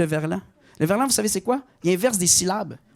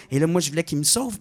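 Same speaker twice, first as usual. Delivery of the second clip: ordinary talk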